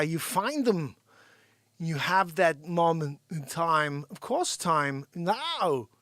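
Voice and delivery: halting impression with odd punched words; Christopher Walken. Hyping a hangout with Joanne for the guys, Walken impression. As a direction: stretch words, pause oddly, hit some loud. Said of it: "you hit 'em with a little Christopher Walken".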